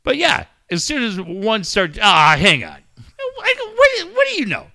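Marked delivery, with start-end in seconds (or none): 3.3-4.7 high squeaky mock-surprised what; mock amazement. Teasing a friend who watches Cobra Kai with mom, mock surprise. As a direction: pretend to be shocked, much higher.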